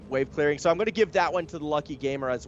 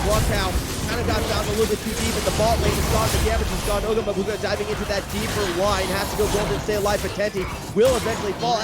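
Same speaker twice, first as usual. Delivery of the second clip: live play-by-play; 3 semitones higher, 3 dB louder than usual. Real, not acted. Caster calling the final fight as the base falls, excited play-by-play. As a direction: call the fight fast and loud as it unfolds.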